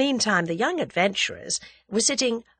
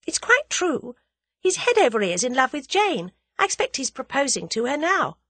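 high, insistent character voice; earnest heroine, pitched well up; spirited young heroine; 8 semitones higher, 3 dB louder than usual. A narrator's reading. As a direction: insist brightly in a raised pitch.

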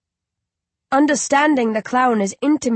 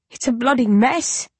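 sobbing; 4 semitones higher than usual. A narrator's reading. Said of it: "I sob".

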